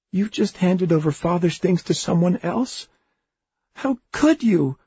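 high, rising outraged question; horrified accusation. A narrator's reading. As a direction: accuse him in disbelief. Alice accuses Oliver, horrified.

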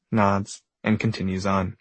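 usual speaking voice

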